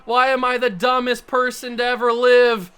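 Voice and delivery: loud, drawn-out wail of frustration; anguished self-berating. Real, not acted. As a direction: wail at yourself, slow and loud. Berates self after losing.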